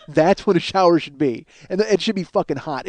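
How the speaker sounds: normal talking voice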